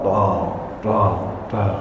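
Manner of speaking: soft low imitated knocks; knocking sound. Corpse in the grave hears it, soft mock knocking. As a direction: tap it out quietly like distant knocking.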